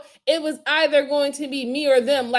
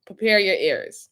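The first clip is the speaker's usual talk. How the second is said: ordinary talk, nothing marked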